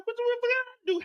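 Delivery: squeaky, high and hurt; mock-offended high voice; high indignant squeak. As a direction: protest in a high wounded voice. His indignant reply.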